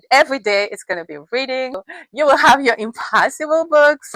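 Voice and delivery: usual speaking voice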